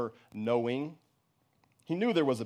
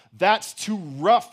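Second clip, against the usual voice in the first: loud, higher-pitched, slow angry snarl; snarled protest; 5 semitones higher, 7 dB louder, much slower than usual. A narrator's reading. Boy to his mother kneading dough, snarling. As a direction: snarl it, dragging out each word. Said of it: "he snarled".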